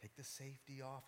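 very soft, low instructions; hushed coaching. Retold or imitated. Dad coaching his young son to aim, hushed. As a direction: talk someone through it without spooking the deer. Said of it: "just, like, telling him".

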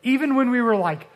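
ordinary delivery

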